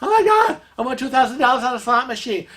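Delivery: high, fast excited squeal; thrilled lady. Retold or imitated. Casino friend telling Kevin about her win, thrilled. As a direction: burst with the news of the win. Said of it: "she goes".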